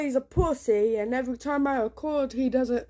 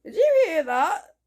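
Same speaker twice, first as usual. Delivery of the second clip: higher, louder startled question; real jolt of alarm, pitched up; 6 semitones higher, 5 dB louder than usual. Real, not acted. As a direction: react sharply to a sudden noise. Startled by a sudden noise.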